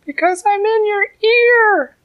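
high, loud little ant; tiny ant voice. A narrator's reading. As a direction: speak up boldly as the tiny ant.

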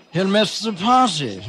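high, slow royal voice; kindly King. Acted. King asking Molly about her father, high and slow. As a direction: ask gently like a storybook king. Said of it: "said the King".

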